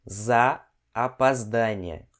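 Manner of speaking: very slow, word by word; slow repeat. Shown as a demonstration. Teacher models the apology phrase for learners, very slow. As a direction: spell the phrase out slowly in sound. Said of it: "Mais uma vez".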